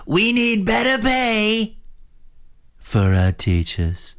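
slow Trump imitation swooping from high to low. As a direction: start way high, drop way low, slowly. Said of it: "he'd go high and he would go low".

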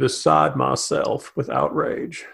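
slow, lightly voiced flat monotone; Mitch McConnell impression. Shown as a demonstration. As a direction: barely move the lips, slowly, no inflection. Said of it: "go as deep as possible".

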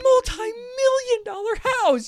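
high, loud outraged yelling; angry rant. Real, not acted. Podcaster yells about an unguarded expensive house, angry rant. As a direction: yell it in sheer exasperation. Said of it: "That made me so angry".

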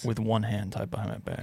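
soft tough-guy boast; John Wick. Pretend. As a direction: add it quietly as the manly action man. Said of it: "that's like a John Wick style right there".

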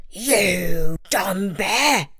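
very slow, rough tiger growl; snarling tiger, drawn out and harsh; scornful tiger. A narrator's reading. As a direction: growl the insult out word by word. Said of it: "The tiger looked him in the eye and replied".

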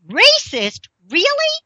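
high, rising disbelief; astonished, one word at a time; incredulous. Real, not acted. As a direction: question it in sheer disbelief, pitch leaping up. Disbelieving reaction to the complaint.

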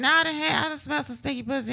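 lightly mimicked man, pitched high; mock dismissive man. Guessing what the man said.

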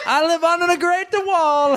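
a crude put-on accent, laughing between lines; mock Chinese impression. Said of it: "you wanna hear my Chinese impression?".

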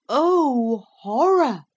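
high, loud, drawn-out exclamation of horror; dramatic horror. A narrator's reading. Narrator reveals the voice is his own, in horror. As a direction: stretch it out loudly, aghast.

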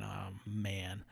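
retold worry. Worried traveller alone in his room, retold quiet worry. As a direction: let it drop to a quiet, worried thought. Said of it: "Now I'm just worried".

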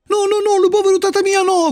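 high, pleading puppet wail; desperate cry. A narrator's reading. Puppet begs for his poor father, crying out desperately. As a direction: beg desperately in a sustained high voice. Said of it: "gridò Pinuccio disperato".

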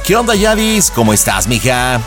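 ordinary delivery

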